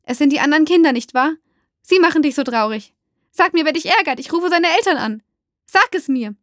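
high, loud, urgent questioning; agitated demand. A narrator's reading. Mother agitatedly presses child for names. (aufgebracht und drängend)

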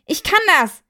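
high, defiant yell; shouting. Acted. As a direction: cry it out stubbornly. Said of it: "schrie er".

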